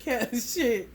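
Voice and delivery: ordinary talk, nothing marked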